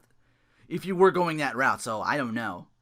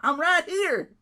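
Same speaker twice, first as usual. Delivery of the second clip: higher and louder, put-on swagger; mock wrestler boast; 9 semitones higher, 6 dB louder than usual. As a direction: gloat like a returning wrestler.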